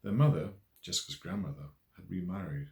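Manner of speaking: plain reading voice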